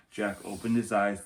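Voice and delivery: ordinary delivery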